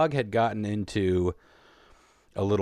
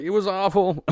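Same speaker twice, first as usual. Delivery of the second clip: high, fast burst into a pained laugh; 13 semitones higher, 4 dB louder than usual. Real, not acted. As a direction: laugh through the awfulness. Sad chicken death, nervous laugh.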